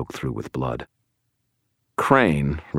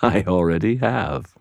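villain's slightly higher, amused gloat; 5 semitones higher than usual. A narrator's reading. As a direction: say it as if laughing at the prisoner. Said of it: "Crane laughed".

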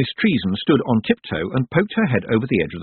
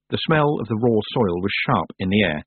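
usual speaking voice

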